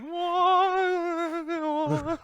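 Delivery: high, airy mock gasp of drama; a hint of melodrama; mock dramatic whoa. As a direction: play it like a sad documentary moment. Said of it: "put violin music in there".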